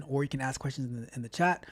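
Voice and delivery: ordinary talk, nothing marked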